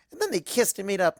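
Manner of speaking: ordinary delivery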